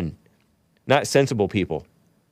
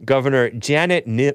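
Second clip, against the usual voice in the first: ordinary talk, nothing marked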